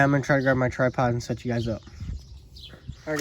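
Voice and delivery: ordinary talk, nothing marked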